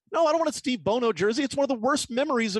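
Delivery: high-pitched exasperated objection; indignant protest. Retold or imitated. Fan refusing a [name] jersey, indignant. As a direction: protest as if appalled by the idea.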